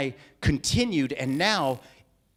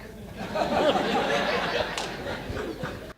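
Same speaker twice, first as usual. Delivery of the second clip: laugh; 9 semitones higher than usual. Real, not acted. Laughs at own demo.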